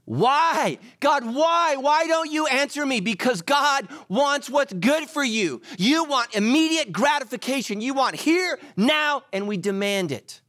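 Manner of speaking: high, loud preaching at full force; impassioned shout. Acted. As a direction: cry out in fervent, demanding protest. Acted demand, shouted preaching to audience.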